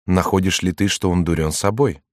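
ordinary delivery

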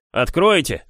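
loud call through the door; stranger calling out. A narrator's reading. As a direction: shout to be let in. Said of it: "сказал неизвестный голос".

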